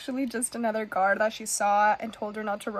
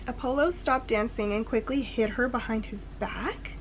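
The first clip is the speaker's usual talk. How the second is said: normal talking voice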